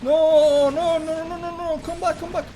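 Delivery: high, loud panicked no's; frantic excited yelling. Real, not acted. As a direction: shout it as the play falls apart. Caster reacts to a tense play.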